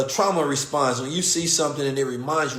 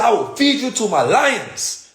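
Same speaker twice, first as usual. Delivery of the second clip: slow, higher, loud imitated threat; menacing threat; 10 semitones higher, 6 dB louder, slower than usual. Owner threatens police with his lions, slow and loud. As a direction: promise them to the lions.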